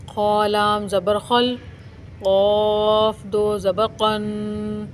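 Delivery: slow, drawn-out syllable spelling; spelling recitation. Shown as a demonstration. Teacher spells खलक़न letter by letter, slowly chanted. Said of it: "जैसे मैं आपको पढ़कर अभी बताती हूँ".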